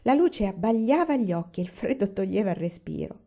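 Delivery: ordinary delivery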